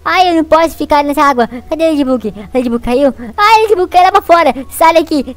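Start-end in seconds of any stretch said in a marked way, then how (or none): 3.4-5.3 high, loud alarm; alarmed cry. Real, not acted. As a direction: cry out as your friend falls. Companion falls, alarmed. (grito assustado e agudo)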